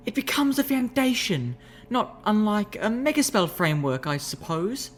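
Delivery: high refined lady's voice, explaining; refined unicorn explaining. Acted. Rarity explaining soul jars, high refined voice.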